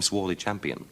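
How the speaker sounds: ordinary delivery, nothing marked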